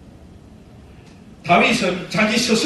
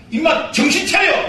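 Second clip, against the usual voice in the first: sharp raised rebuke; self scolding; 6 semitones higher than usual. Acted. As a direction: snap yourself awake. Scolding oneself to wake up.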